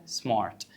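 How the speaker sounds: سمارت with a gentle, light R after the A; soft R. Shown as a demonstration. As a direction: say سمارت with the R softened, not rolled. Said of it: "سمارت بالراحة". (نطق سمارت بحرف R خفيف بالراحة)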